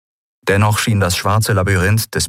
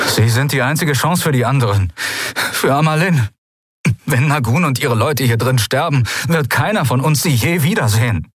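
pleading; 6 semitones higher than usual. A narrator's reading. Sephiron pleads with Goskin.

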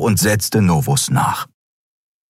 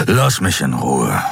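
lower, slow beast voice shutting her out; curt rebuff; 4 semitones lower than usual. A narrator's reading. Kero pushing Xea away, slow lower rebuff. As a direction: cut her off, slowly and low.

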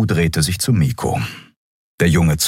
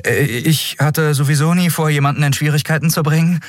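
put-on high, hesitant boy's voice; embarrassed; 11 semitones higher than usual. A narrator's reading. Boy excusing himself to the crew, high and embarrassed. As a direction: start haltingly, then rush it out sheepishly. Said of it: "sagte er verlegen".

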